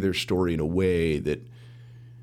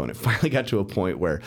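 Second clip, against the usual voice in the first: ordinary talk, nothing marked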